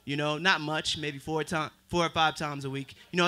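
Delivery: ordinary talk, nothing marked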